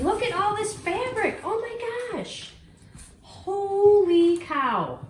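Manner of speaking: high and drawn out with delight; thrilled. Real, not acted. Showing viewers her prize fabric, thrilled and drawn out. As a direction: gush over the fabric, slowly.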